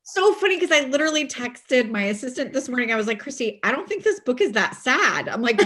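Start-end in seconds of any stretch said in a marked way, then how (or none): 3.2-5.3 higher-pitched mock-baffled insistence; retold protest. Author retelling her text to her assistant, protesting. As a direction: recount your puzzled protest, pitched up.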